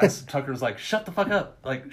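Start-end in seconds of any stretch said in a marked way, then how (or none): 0.8-1.6 higher-pitched urgent shush; retold urgent hushing. Tucker silencing his friend in front of the cop, urgent hushing. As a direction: cut the friend off in a high voice.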